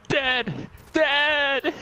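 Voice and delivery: high-pitched restrained yell; held-back scream. Acted. Friend screaming for Dad as zombies break in, held back. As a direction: call for help, keeping the volume down. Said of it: "imagine you're saying it louder than that".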